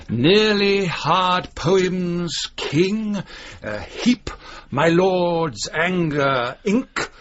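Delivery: very slow, relishing each anagram; savouring anagrams. Acted. Professor reciting his anagrams to visitors, very slow. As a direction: draw out each phrase as if tasting it. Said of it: "all the possible anagrams".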